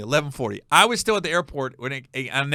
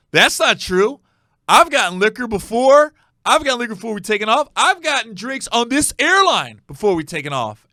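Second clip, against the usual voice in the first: louder and higher, insisting over and over; drunk indignant protest; 6 semitones higher, 7 dB louder than usual. Retold or imitated. Tipsy passenger argues with the flight attendant, loud and indignant. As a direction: argue like a tipsy passenger who knows his rights. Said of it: "He goes".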